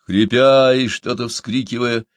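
usual speaking voice

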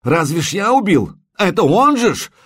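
резко выше и громче, в панике; отчаянно переложи вину; моля. A narrator's reading. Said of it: "взмолился Карш". (паническая мольба, почти крик)

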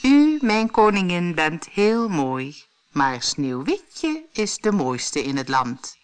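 slow, otherworldly measured voice, falling to the end; mirror answering. Acted. Magic mirror tells the queen the truth, slow eerie voice. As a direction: hand down the verdict slowly. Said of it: "De spiegel antwoordde".

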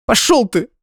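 high, loud tearful retort; sobbing snap. Acted. Crying girlfriend telling him off, high tearful snap. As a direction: spit it out through tears. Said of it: "всхлипнула девушка".

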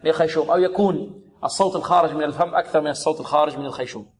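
ordinary talk, nothing marked

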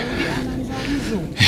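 sudden high-pitched laugh. Real, not acted. Laughing at his wife.

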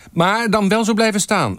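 very high-pitched shouted instruction; good-humoured calling out. A narrator's reading. Photographer telling a colleague to stay put, calling out. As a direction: call it across, keeping them in place. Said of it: "riep Wim Bosman lachend".

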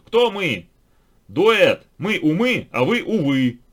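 high, loud shouted reply; loud shouting. A narrator's reading. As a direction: shout it across to the door. Shouted exchange at the door.

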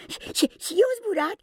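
put-on very high, stammering small child; a toddler tripping over the words; clumsy little boy. Little Michael asking the others clumsily if he flies too. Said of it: "adăugă stângaci Michael".

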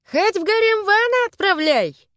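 high-pitched sneering catcall; snide jeer. A narrator's reading. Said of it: "ехидно заметил кто-то из стражей".

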